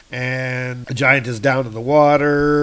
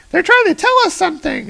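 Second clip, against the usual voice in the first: put-on very high, fast kid voice; 6 dB louder, much faster than usual. Kevin explains the ravens to Mick, fast high kid. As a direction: rush it out high like an eager boy. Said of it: "It says".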